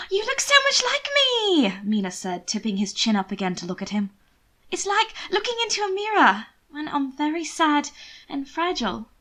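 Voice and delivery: a sister coaxing her brother gently; pleading sister. A narrator's reading. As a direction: beg him sweetly. Mina tender to brother.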